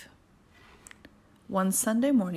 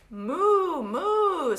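high, loud storybook moo; raised, drawn-out cow call; 9 semitones higher, 5 dB louder than usual. Acted. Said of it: "said the cow".

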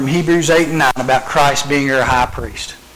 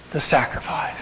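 breathless whisper; 5 semitones lower, 12 dB quieter, slower than usual. Real, not acted. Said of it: "you can't do that".

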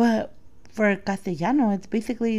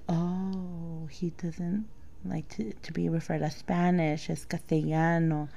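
soft, slow, low dawning thought; hushed realisation; 3 semitones lower, 11 dB quieter, much slower than usual. Retold or imitated. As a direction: murmur it slowly as it dawns. Retelling a quiet dawning realisation.

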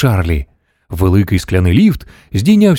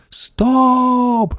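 high, drawn-out storybook shriek; 13 semitones higher than usual. A narrator's reading. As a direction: shriek it way up high without much volume. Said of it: "верещала бабуся Джозефіна".

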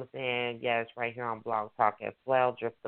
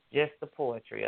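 usual speaking voice

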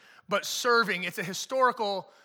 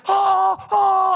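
loud, higher whooping oh; hyped bench cry; 8 semitones higher, 11 dB louder than usual. Retold or imitated. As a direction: react like a bench guy holding teammates back. Bench player reacting to dunk.

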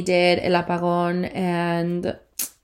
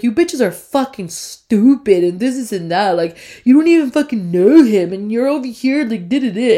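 put-on scoffing boyfriend; 3 semitones higher, 5 dB louder than usual. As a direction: sneer it like a hater. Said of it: "my boyfriend's always like".

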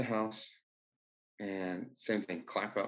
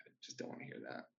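low fast muttering; low rushed grumble; annoyed under-breath mutter; 8 semitones lower, 12 dB quieter, faster than usual. Retold or imitated. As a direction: mumble it quickly to yourself, annoyed.

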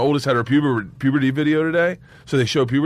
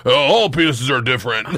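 put-on low grown-up voice; mock deep voice, calming someone down; 3 semitones lower than usual. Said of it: "[name] in the deep voice".

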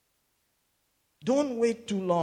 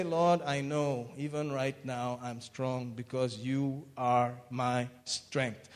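slow, soft, intimate prayer; spoken prayer; 6 semitones lower, 7 dB quieter, slower than usual. Shown as a demonstration. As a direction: pray it slowly and quietly to the Lord. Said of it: "So just say".

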